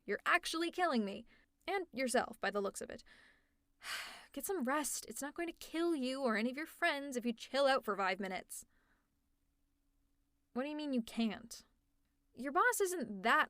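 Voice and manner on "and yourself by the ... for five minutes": normal talking voice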